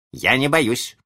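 put-on high-pitched childish boast; defiant child. A narrator's reading. Small son squabbling with his father, defiant.

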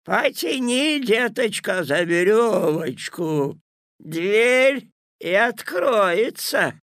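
high, slow fake granny voice; wolf putting on a sweet granny; wolf as grandma. A narrator's reading. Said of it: "разрешил волк".

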